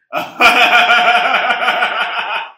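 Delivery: loud, high, rolling laughter; long hearty laugh. Real, not acted. Teller joking about chatting calmly with mother, long loud laugh. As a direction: burst out laughing and keep going. Cracking up at own story.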